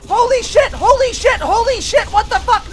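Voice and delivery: high, loud terrified yelling; freaked-out shrieks at a surprise attack; panicked screaming. Real, not acted. Deathclaw ambush, screaming.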